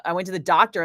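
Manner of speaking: ordinary talk, nothing marked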